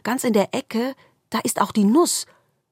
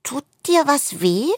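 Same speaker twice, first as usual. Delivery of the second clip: much higher, small concerned question; worried squirrel; 10 semitones higher than usual. A narrator's reading. Squirrel asks the darkness if it hurts, high and timid. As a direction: ask timidly in a tiny high voice. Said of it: "fragt das Eichhörnchen".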